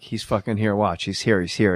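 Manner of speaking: low, quiet Norm aside; Norm impression. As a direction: mutter it low, like he's in the room. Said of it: "I do Norm Macdonald".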